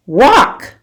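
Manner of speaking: stern mom voice; stern command. Acted. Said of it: "she says".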